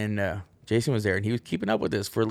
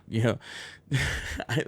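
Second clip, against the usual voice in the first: normal talking voice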